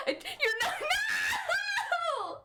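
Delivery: high, loud laughing cry; laughing shout. Real, not acted. Streamer laughing and shouting at departing viewers.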